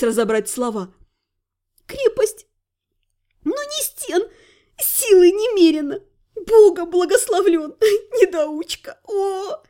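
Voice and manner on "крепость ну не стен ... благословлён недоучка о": high, breathless laughing; laughing through tears. Acted. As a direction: gasp it out between sobs of laughter. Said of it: "рыдала от смеха".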